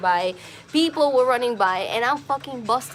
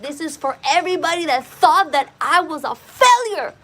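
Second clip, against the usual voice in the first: louder, higher, voice strained by tears; tearful defiant cry; 5 semitones higher, 5 dB louder than usual. Retold or imitated. Dancing on the sidewalk, crying out to everyone who called him a failure. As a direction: hurl it at every doubter through sobs. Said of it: "I was just fucking just bawling".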